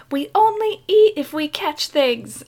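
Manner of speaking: high, loud imitation of an eager boy; excited kid. Boy to mom out in the boat, loud excited imitation. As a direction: declare it like a fired-up child.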